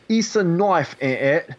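a clumsy attempt at a British accent. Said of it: "the only thing I can do is".

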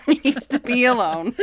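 repeating the line through laughter; laughing. Retold or imitated. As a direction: crack up while you quote it. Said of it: "repeating it laughing".

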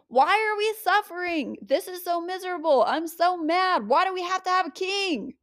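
hammed-up complaining people; whiny complaint. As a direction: whine like miserable people. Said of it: "And then they say".

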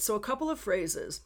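normal talking voice

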